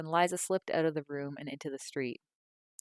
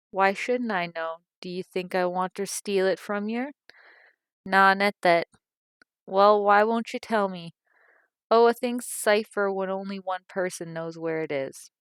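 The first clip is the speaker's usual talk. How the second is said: raised, heated voices over the money; heated quarrel; 3 semitones higher, 8 dB louder than usual. A narrator's reading. Mother and daughter arguing about hidden wages, loud and heated. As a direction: push back hard, getting louder.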